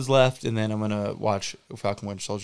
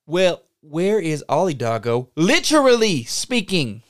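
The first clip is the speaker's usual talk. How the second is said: much higher, louder, slow demand; exasperated shout; 6 semitones higher, 6 dB louder than usual. Acted. Interrogator losing patience, rising furious demand. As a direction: blow up demanding the answer.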